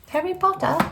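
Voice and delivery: put-on high, light British-accent take on Harry Potter. Tarot reader playfully saying the name in British accent. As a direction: say it like a posh British fan. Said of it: "I was speaking in a British accent".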